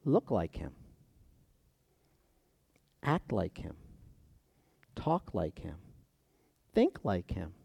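very soft, spaced-out list; quiet, measured, reverent preaching; hushed. Real, not acted. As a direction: let each phrase drop almost to nothing. Hushed list closing the point.

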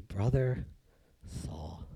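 hushed, halting, dropping very low on the name; tender greeting. Acted. Ananias laying his hand on Saul, hushed tenderness. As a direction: say it softly, pausing before the name.

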